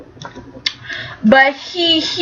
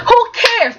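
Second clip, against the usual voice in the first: mock exasperation; 9 semitones higher than usual. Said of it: "she was like".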